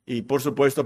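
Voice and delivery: ordinary talk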